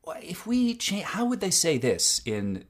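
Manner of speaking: high-pitched mock query from the crew; imitating production crew. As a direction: put on a high voice, breaking off and restarting. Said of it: "the writers and the producers and the actors and the director will come to you".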